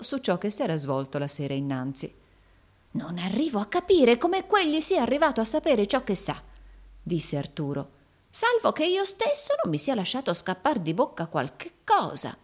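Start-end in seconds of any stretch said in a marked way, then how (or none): 2.9-6.4 high-pitched voice of a schemer puzzling aloud; puzzled old schemer. A narrator's reading. Arturo musing alone in the parlour, puzzled high voice. 8.3-12.4 high-pitched voice of a schemer puzzling aloud; puzzled old schemer. A narrator's reading. Arturo musing alone in the parlour, puzzled high voice.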